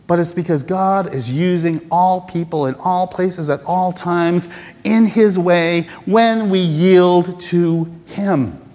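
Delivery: ordinary talk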